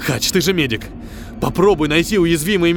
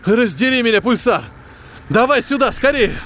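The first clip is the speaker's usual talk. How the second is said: high, loud alarmed call; urgent shout; 9 semitones higher, 3 dB louder than usual. A narrator's reading. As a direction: hurry her in, loud and tense.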